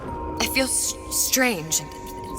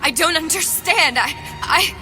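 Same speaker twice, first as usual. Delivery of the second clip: raised-pitch bewildered stammer; upset, higher-pitched disbelief; distressed confusion; 6 semitones higher than usual. Acted. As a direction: falter in rising distress. Woman confused at her death.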